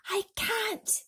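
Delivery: cry. A narrator's reading. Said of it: "cried James".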